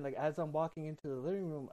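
ordinary delivery

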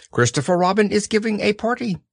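high, fussy owl; bright know-it-all bird. Acted. Owl speaking.

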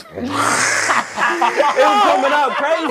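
slow, high, laughing through the words. Real, not acted. Telling friends the wild story, laughing. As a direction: crack up as you say it.

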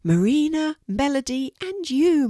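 gleeful. A narrator's reading. Cheered-up mermaid gleefully naming who makes her smile. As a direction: burst out happily. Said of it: "she gleefully said".

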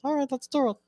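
comic high-pitched patient; unique high voice. Acted. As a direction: put on a very high, silly voice. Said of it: "why your voice is like that".

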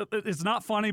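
can't quite keep a straight face; laughing. Real, not acted. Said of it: "excuse my laugh".

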